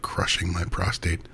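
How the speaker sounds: usual speaking voice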